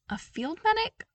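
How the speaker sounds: high, rising disbelief; surprised question. Acted. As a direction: ask it in surprise, with a high rise on "medic". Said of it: "Oh, come on".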